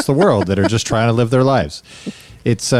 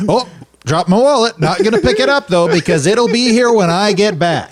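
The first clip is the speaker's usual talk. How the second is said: high, airy fake-relaxed voice; 7 semitones higher than usual. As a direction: pretend to be totally at ease.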